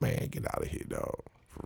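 whisper. Real, not acted. Dismissing the trolls.

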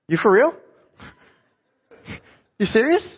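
high, loud mock disbelief; incredulous. Chaperone to a little girl wanting his phone, acted incredulous. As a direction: retort in high-pitched astonishment. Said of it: "I couldn't believe it".

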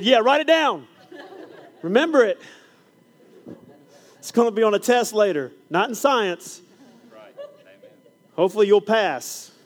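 high, loud, laughing tease; teacher teasing students with a loud laugh; playful teasing. Retold or imitated. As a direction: joke with the kids loudly.

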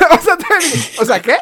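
loud, high, laughing disbelief; can't believe his luck; stunned disbelief. Real, not acted. Telling friends how shocked he was by the reprieve.

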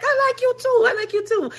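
very high, loud mock protest; imitated eager man. Man answers her "I actually like you", mock high-pitched insistence. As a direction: squeal it insistently as a hammed-up impression. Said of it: "He's like".

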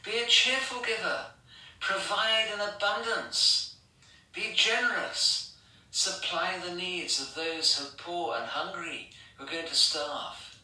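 high, ringing exhortation; rally them to give, bright and insistent; apostle exhorting. Retold or imitated. Preacher voices the apostle urging generosity for the famine, pitched high. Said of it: "He says".

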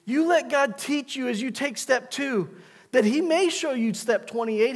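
ordinary talk

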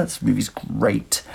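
normal talking voice

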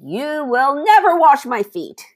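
high, slow, emphatic protest. A narrator's reading. Peter refusing to let Jesus wash him, protesting. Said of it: "Peter protested".

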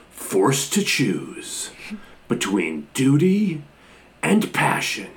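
lightly imitated trailer announcer, slow with dramatic pauses; movie trailer voiceover. Host quotes the trailer's line to the co-host, mock trailer voice. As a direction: draw out each phrase like a mock trailer narrator. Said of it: "doing the trailer voice".

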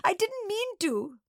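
high, slow, whimpering wail; drawn-out tearful protest; sobbing. A narrator's reading. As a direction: plead tearfully like a child. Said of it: "Nana sobbed".

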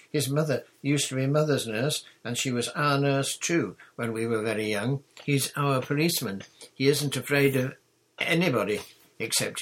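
lightly voiced bossy girl, full of news; confident girl captain. A narrator's reading. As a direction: explain eagerly with bold assurance.

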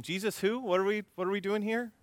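high-pitched mock innocence; feigned ignorance. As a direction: play clueless, pitch lifted high. Peter feigning ignorance, acted.

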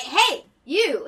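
loud, high mock police shout; imitating a cop hailing a suspect; calling out. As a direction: holler to stop someone leaving.

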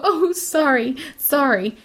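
put-on pitiful little apology; puppy-dog apology. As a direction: imitate a needless, simpering sorry. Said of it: "look at me with puppy dog eyes and go".

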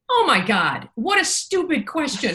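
exasperated editor. Retold or imitated. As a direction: toss it off like it's obvious. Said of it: "And she goes".

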